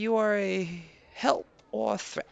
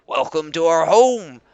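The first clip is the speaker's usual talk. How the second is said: Leader Koba, proud; 5 semitones lower, 9 dB louder than usual. Acted. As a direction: greet guests with stiff pride. Koba speaks as NPC.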